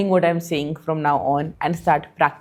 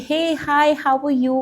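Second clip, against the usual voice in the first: higher, sugary hello to a friend; overly sweet greeting; 8 semitones higher than usual. Retold or imitated. Sweetly greeting a friend who's not in the mood, higher and sugary. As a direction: sweeten it like a fake-nice hi. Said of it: "I'd be really sweet to you".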